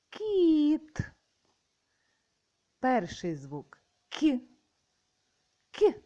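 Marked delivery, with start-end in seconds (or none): 0.1-1.0 Кіт announced high and loud; called out. Shown as a demonstration. Teacher announcing the next word Кіт, high and loud. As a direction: call out Кіт to the class. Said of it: "Наступне".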